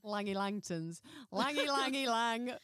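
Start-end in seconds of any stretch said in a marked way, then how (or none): 1.3-2.6 giddy, bouncy sing-song chant; hyper sing-song. Real, not acted. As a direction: play with the name like a silly rhyme. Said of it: "have you had a Red Bull or something".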